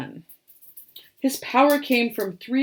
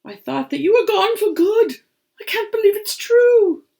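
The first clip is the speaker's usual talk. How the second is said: high, loud delighted disbelief; joyful disbelief; 12 semitones higher, 7 dB louder than usual. Acted. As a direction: grandpa overjoyed to see his rabbit again. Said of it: "Grandpa cried with joy".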